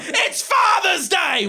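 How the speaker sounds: high, slow yell; mimicked mum's urgent shout; all-caps mum. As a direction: shriek it word by word like capitals. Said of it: "accidentally set her text messages to all caps".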